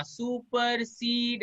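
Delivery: Supersede with long, dragged syllables; wrongly drawn out. Shown as a demonstration. Teacher imitating how not to say it, drawn out. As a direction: draw out every syllable of supersede slowly. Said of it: "ऐसे नहीं बोलेंगे".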